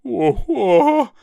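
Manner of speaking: the great beast cries out; beast's cry. Acted. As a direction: Banderbear crying out as he lurches off. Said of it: "he cried".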